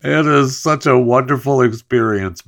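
high-pitched, amused gush straight out of a laugh. Real, not acted. Host cracking up while praising the meat delivery, laughing. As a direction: say it through the tail of a laugh. Breaks up laughing mid ad read.

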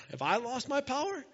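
high, rising question of disbelief; God, incredulous. Acted. God challenging Moses over his doubt, rising incredulity. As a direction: climb steadily to an astonished question. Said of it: "I love how God's like".

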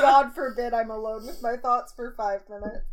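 loud, overblown tweet reading; mock dramatic quote. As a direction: read it like a melodramatic complaint.

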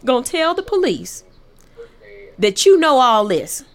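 mother's incredulous scolding; scolding mom. Retold or imitated. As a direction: mom scolding her daughter about the police. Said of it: "that's how she said it".

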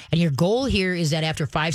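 ordinary delivery

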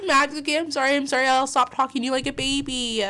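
high, hurried mock apology; high apologetic owner.

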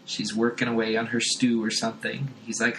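ordinary talk, nothing marked

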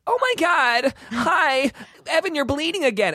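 high whiny squeal; annoying voice. Retold or imitated. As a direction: pitch it way up and whine. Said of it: "he would do that voice".

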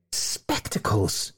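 drawn-out, voiceless, soft scoff; derisive neigh. A narrator's reading. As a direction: scoff it slowly under the breath. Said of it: "he neighed".

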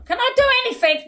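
high, fast, exasperated whine. Real, not acted. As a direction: protest in a high, quick whine. Told off for vaping.

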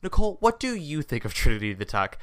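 pointed, deliberate question, lifted pitch; quoting cohost's question. Retold or imitated. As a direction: pose it like a weighty question. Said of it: "takes a moment and he goes".

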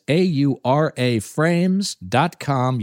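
slow, letter by letter; spelled out slowly. Shown as a demonstration. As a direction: spell each letter out for the listener. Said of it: "spelled out for listeners".

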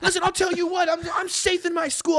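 high-pitched, worked-up comeback; mock British outrage. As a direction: imitate someone indignantly firing back. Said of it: "they just rip on you".

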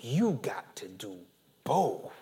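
a hushed, breathy aside after the shouting; whisper. Real, not acted. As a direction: drop it down to a secret.

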